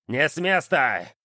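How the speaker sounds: slow, stern order to halt; stern command. A narrator's reading. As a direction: freeze them with a command. Clan head stops them.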